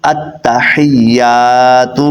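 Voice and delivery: slow tajweed model of التحیات; correct recitation. Shown as a demonstration. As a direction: chant التحیات very slowly with a clear ح and a long held "yaa". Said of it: "کیا بنا؟".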